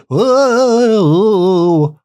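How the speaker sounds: long, high wavering "whooo"; ghostly wail. Shown as a demonstration. Podcaster demonstrates the ghostly wailing to co-host, high drawn-out wail. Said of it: "wailing, the voice, a scream".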